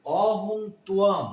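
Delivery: ত্বম said as twam with a clear wa glide; slow, loud ত্বম, stressing the w; pronunciation demo. Shown as a demonstration. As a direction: give ত্বম its wa sound. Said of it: "ব ফলা থাকলে ওয়া উচ্চারণ হয়".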